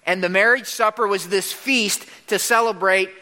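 usual speaking voice

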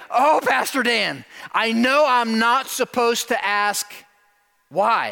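high, loud, drawn-out pious apology; slow, exaggerated contrite pleading; mock religious apology. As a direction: imitate a churchgoer apologizing to the pastor. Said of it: "they apologize to me".

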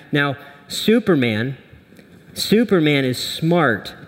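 ordinary delivery

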